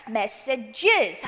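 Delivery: "messages" ending in a clear "jiz"; voiced "-is" ending. Shown as a demonstration. Teacher showing the plural, extra "is" syllable. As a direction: say "messages" slowly with a full "is" sound. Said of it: "is 사운드가 나야".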